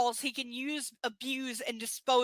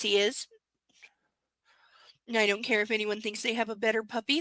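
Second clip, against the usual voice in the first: normal talking voice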